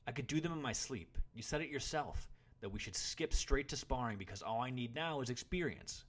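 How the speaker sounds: loud, high indignant protest; retorting. A narrator's reading. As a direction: argue back hotly at your teacher. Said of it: "retorted the boy".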